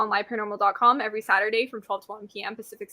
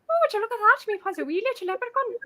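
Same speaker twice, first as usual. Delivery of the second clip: high-pitched put-on northern Irish accent; 10 semitones higher, faster than usual. Shown as a demonstration. Guest shows the hosts her high-pitched Irish accent. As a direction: lift the voice into an Irish lilt. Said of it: "then the Irish is like".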